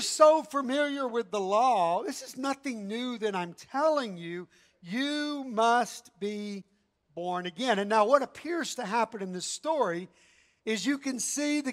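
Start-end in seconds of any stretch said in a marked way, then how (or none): 4.8-7.8 You must be born again drawn out slowly, each word weighted; slow it right down on must be; slow emphasis. Acted. Preacher to congregation, slowly and weightily. Preacher drives home the key phrase.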